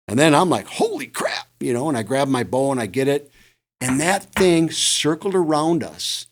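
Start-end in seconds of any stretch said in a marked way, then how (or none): 0.7-1.5 high, hushed exclamation of shock; retold astonishment. Hunter recalls seeing the deer charge in, hushed astonishment. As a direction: gasp it out softly, pitch leaping up.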